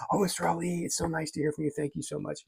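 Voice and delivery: a light put-on of the wife's sweet thanks; imitating wife. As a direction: play her thrilled and gracious, gently. Wife's delighted reply voiced.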